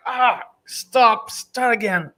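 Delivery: high, annoyed stop-and-restart; irritated at a botched take; pissed off. Retold or imitated. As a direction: snap at yourself to start over. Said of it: "really pissed".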